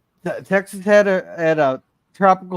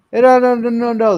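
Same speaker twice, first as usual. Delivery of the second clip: emphatic no; 7 semitones higher, 5 dB louder than usual. Real, not acted. Jokingly correcting chat's wrong claim.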